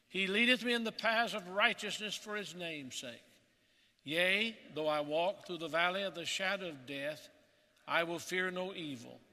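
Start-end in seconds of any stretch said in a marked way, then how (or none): none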